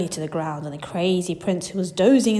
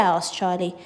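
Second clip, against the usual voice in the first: usual speaking voice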